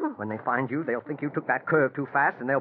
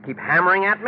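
higher, louder exasperated outburst; angry shout; 7 semitones higher, 7 dB louder than usual. Acted. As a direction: snap at her, fed up. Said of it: "Please don't shout at me".

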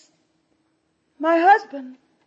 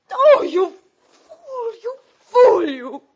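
slow, raised, tearful bitterness; anguished reproach; 3 semitones higher, 5 dB louder, slower than usual. Acted. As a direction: wail it at him in despair. Wife laments husband's ruinous haste.

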